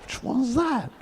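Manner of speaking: high, alarmed little voice; nervous question. Retold or imitated. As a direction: ask warily, suddenly scared. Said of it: "I was like".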